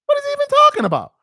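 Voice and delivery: high, loud and fast in disbelief; exasperated outburst. Real, not acted. Podcaster reacting to the clip, loud exasperated disbelief. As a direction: throw up your hands at it.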